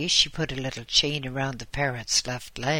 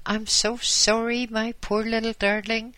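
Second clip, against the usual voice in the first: put-on high, louder fussing old lady voice; fond apology; 9 semitones higher, 4 dB louder than usual. Old lady apologizing to her parrot, high fussing. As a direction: coo an apology to a pet.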